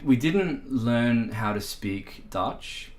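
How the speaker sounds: normal talking voice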